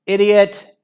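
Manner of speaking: high, loud angry yell; scream. A narrator's reading. Dad abusing the goalkeeper, screaming. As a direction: yell the insult out. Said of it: "screamed Aerobic Al's dad".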